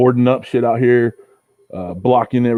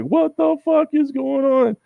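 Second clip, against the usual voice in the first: slow, high, drawn-out disbelief; incredulous high whine; slower than usual. Retold or imitated. Asking Ricer what is happening, slow high disbelief. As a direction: stretch it out in stunned disbelief.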